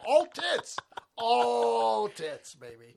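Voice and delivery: loud, high whoop of a cheer; excited shout. Acted. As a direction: belt it out like a cheer. Riffing on a joke, shouting.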